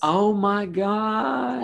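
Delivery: slow, high, drawn-out gasp; excited. Real, not acted. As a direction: squeal with delight. Delighted shared costume.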